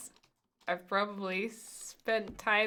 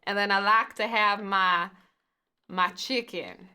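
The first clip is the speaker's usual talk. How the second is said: slow, louder attempt at an American Southern accent; mock southern accent; 5 dB louder than usual. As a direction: drag the words in a hammed-up Southern accent. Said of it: "Is that southern?".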